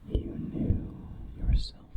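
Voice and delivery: ordinary delivery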